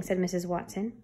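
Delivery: plain reading voice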